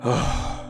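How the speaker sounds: long unvoiced sigh of surrender; exasperated sigh. Boss giving in to an insistent employee, exasperated sigh. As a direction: let out a resigned breath.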